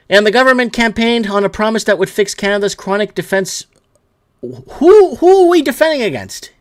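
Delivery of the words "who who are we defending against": higher, louder demand; angry rhetorical shout. Real, not acted. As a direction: fire the question at the audience.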